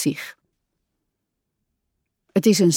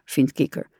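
ordinary delivery

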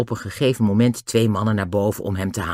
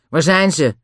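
higher-pitched, surly demand; gruff; 6 semitones higher, 4 dB louder than usual. A narrator's reading. Lou demands to know where they are, gruff. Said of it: "zei Lou nors".

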